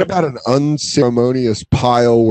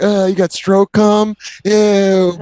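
slow, high "ew" of fake disgust; mocking disgust; 8 semitones higher, much slower than usual. Nineteen-year-olds teasing a roommate, slow high mock disgust. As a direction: drag it out like it grosses you out.

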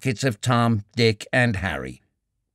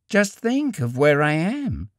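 slow, higher, half curious; awed young woman; 5 semitones higher than usual. A narrator's reading. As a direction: savour the daring, slowly. Young woman voiced, daring.

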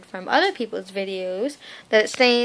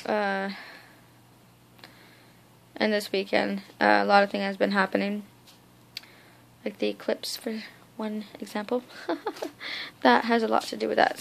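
usual speaking voice

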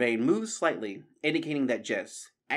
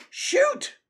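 very high, loud yell; piercingly high battle cry; yelled command; 7 dB louder than usual. A narrator's reading. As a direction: yell the order to shoot. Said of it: "Matthias yelled".

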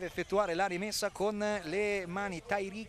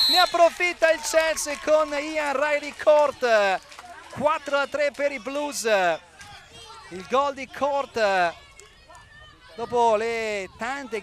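high, loud, stretched goal shout; goal call; 6 semitones higher, 10 dB louder than usual. Real, not acted. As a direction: erupt as the ball goes in. Goal scored for Chelsea.

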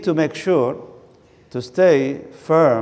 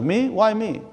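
mock indignant complainer; 7 semitones higher, slower than usual. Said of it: "It's like".

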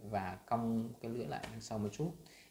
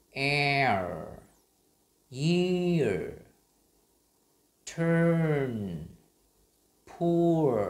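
each word loud and higher, pause between; drill reading; 4 semitones higher, 8 dB louder, much slower than usual. Shown as a demonstration. Teacher leading the r-sound drill. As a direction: call out words for class to repeat. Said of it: "Các bạn cùng đọc theo mình".

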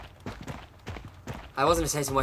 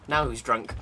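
ordinary talk, nothing marked

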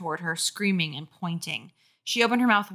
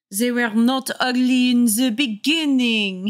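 bitter interruption; 5 semitones higher, 5 dB louder than usual. Acted. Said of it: "his voice bitter".

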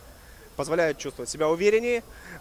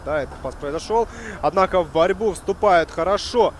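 normal talking voice

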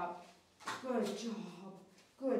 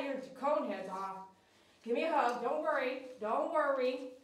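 usual speaking voice

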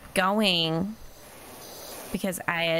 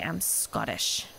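"I'm Scottish" lower and proud, with weight; with oomph; 7 semitones lower, 4 dB quieter than usual. Shown as a demonstration. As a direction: give "I'm Scottish" real pride, voiced lower. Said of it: "doesn't have the same oomph to say".

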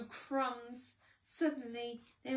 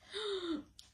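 high gasp; 11 semitones higher than usual. Acted. Narrator gasps.

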